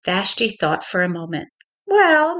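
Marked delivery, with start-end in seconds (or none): 1.8-2.4 defiant well. Acted. Vashti's defiant realization.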